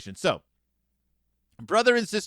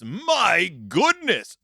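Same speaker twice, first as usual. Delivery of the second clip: slow, drawn-out stern dad voice; a mild hammed-up fatherly outburst; Papa erupting; 2 semitones higher, 3 dB louder, much slower than usual. Papa scolding both children at the table, mock stern. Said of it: "Papa erupts".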